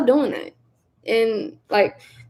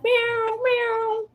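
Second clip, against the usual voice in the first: high, soft little cat meows; imitated meow; 13 semitones higher, 6 dB quieter than usual.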